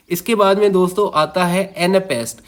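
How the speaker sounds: ordinary delivery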